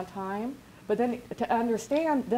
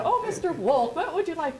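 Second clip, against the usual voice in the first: higher and louder, fawning offer; eager attendant; 7 semitones higher, 3 dB louder than usual. Retold or imitated. Phone agent offering Rick upgrades, eagerly. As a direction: gush over the valued guest.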